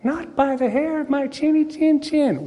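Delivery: high, piping little pig; squeaky pig voice. Retold or imitated. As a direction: answer the wolf in a tiny pig squeak. Third pig answers the wolf.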